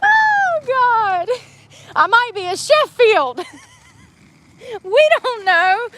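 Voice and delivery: high, gleeful squeal of surprise; thrilled and giggly, voice flying up; giddy excitement. Real, not acted. Giddy about possible new surname.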